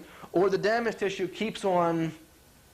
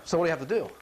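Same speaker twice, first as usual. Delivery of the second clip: ordinary talk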